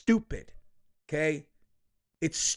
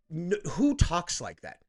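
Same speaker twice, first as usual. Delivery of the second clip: ordinary talk, nothing marked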